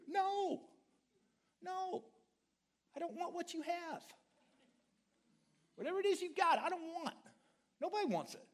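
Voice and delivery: preacher refusing the complainer, emphatic; firm refusal. Acted. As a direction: push it away firmly.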